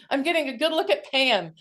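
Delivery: amused through laughter; laughing. Real, not acted. Amused seeing friend on screen.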